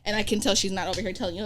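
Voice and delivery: normal talking voice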